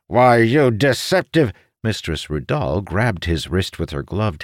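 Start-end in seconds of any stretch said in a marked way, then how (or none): 0.1-1.5 loud, high outburst, cut off; shouting. A narrator's reading. Calamir swinging at Mistress Rudal, angry shout. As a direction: shout it as you swing a fist. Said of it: "while shouting".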